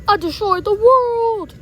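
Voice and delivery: slow, very loud proclamation; booming cartoon-villain announcement; villain's triumphant shout. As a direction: boast loudly, stretching the words. Villain proclaims his victory.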